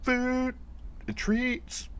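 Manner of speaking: very high, drawn-out bullhorn imitation; bullhorn call. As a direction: draw it out way up high, like calling through a bullhorn. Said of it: "drive by with a bullhorn and just say".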